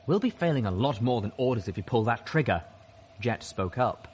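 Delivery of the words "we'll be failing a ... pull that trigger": plain reading voice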